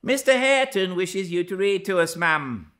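high, loud, quick announcement; loud, forthright request; calling out. A narrator's reading. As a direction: pipe up briskly for all to hear. Relaying the secret request aloud.

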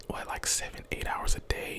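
normal talking voice

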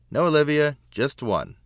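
a loud, high no from mum; firm mother. Acted. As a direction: put your foot down, loudly. Mother refusing more books.

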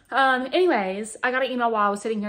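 fast excited catch-up; excited rush. Real, not acted. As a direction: burst into the news. Sudden louder excited story start.

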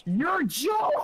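high, stretched-out squeal; exasperated outburst. Real, not acted. Player fed up with bad luck, exasperated outburst. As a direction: squeal it out in frustration.

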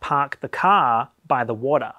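Park the car by the water with no R sounds, Australian style; non-rhotic Australian accent. Shown as a demonstration. Contrasting her own Australian accent with the rhotic one, non-rhotic. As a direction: drop every R in car and water, slowly. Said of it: "Australian and most British and Kiwi accents are non-rhotic".

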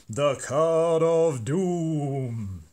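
slow, booming game show announcer. Pretend. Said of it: "Best game show voice there".

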